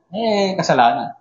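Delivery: usual speaking voice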